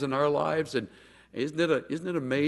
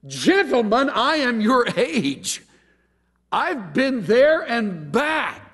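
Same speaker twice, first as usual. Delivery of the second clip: indignant Job; 9 semitones higher, slower than usual. Retold or imitated. Job addressing friends.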